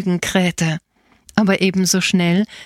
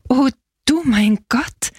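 higher, dawning astonishment; stammered amazement; 5 semitones higher than usual. A narrator's reading. Boy grasping his mother's news, mildly overwhelmed. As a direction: let the realization lift the voice. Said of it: "stammelte der Bub".

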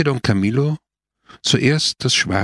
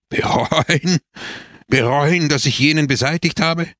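higher-pitched, mock-amused disbelief; laughing scornfully; 6 semitones higher than usual. A narrator's reading. Biondo scoffs at repenting the killing, laughing. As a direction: throw it back as a scoffing question. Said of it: "hell auflachend".

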